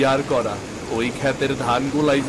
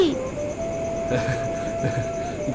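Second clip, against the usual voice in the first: gentle laugh; 5 dB quieter than usual. Acted. Father soothes scared daughter.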